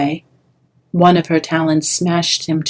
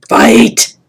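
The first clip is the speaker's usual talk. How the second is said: loud, raging howl; 5 semitones higher, 8 dB louder than usual. Acted. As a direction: bark the order at him. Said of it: "his attacker howled".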